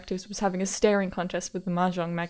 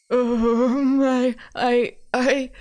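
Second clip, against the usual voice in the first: loud, raised, stammering distress; quivering panic; 5 semitones higher, 7 dB louder, much slower than usual. Acted. Panicking caller phones the detective, quivering and hoarse. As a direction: stammer loudly as the frantic caller. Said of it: "His voice was quivering and hoarse".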